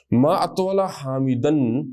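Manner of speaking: ما اطول حامدن then کتنا لمبا ہے Hamid, very slow and even; slow model reading. Shown as a demonstration. Teacher reciting the example and its translation, very slow. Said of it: "یہ اس کا محاوراتاً ترجمہ ہے".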